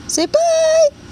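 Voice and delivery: very high, loud sing-out. Real, not acted. Mother coaxing her baby girl to say bye, high-pitched baby talk.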